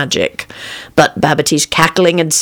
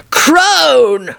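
higher, drawn-out angry call; mild roar; 10 semitones higher than usual. A narrator's reading. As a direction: roar it with restrained volume. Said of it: "roared the charlatan".

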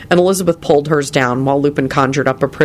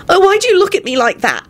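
much higher, pleading and intense; passionate; 3 dB louder than usual. A narrator's reading. Elizabeth pleading with Lupin about his odd look, passionate and high. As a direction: demand an answer from him with feeling. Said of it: "she said passionately".

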